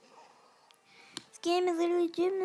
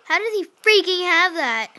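frustrated shout; 3 semitones higher, 11 dB louder than usual. Real, not acted. Frustrated gamer outburst.

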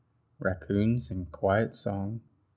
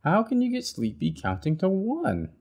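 exasperated Bird; 10 semitones higher than usual. Acted. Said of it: "shouted Bird".

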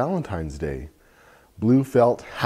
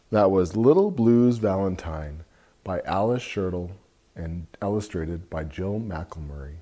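ordinary delivery, nothing marked

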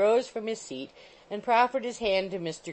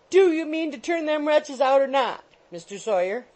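high, loud landlady's ultimatum; shrill demand; 8 semitones higher, 6 dB louder than usual. A narrator's reading. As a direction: confront the lodger shrilly. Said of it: "the shrill voice of Missus Rattle".

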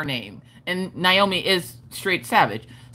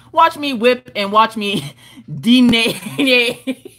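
laughing; 4 semitones higher, much slower than usual. Acted. Laughing through a song line.

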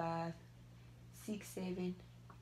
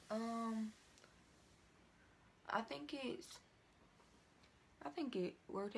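talking through dried mask; limited cheek movement; stiff face mask on; 7 dB quieter than usual. Real, not acted.

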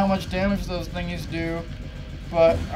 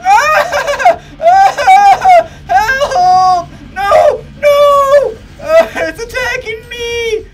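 high, loud screams broken by laughter; laughing screams; 12 semitones higher, 10 dB louder, much slower than usual. Real, not acted. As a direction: scream for help half laughing. Gamer screams while attacked.

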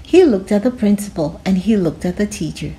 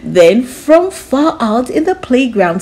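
plain reading voice

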